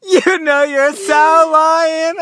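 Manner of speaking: high laughing, drawn out slowly; laughing tease. Real, not acted. Laughing as she teases her friend for lying. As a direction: laugh it out while calling her bluff.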